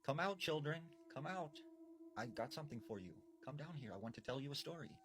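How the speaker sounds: a hint of a witch coaxing kids out of hiding; luring old woman. Old woman in the house coaxing hidden kids down, lightly voiced sweet lure. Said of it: "this old woman started saying".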